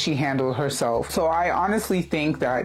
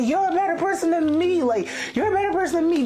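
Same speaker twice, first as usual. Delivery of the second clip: much higher mocking gushing voice; mock commenters; 13 semitones higher, faster than usual. Quoting her commenters in a much higher mock gush. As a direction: mimic the gushing comment-section crowd. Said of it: "almost everyone was like".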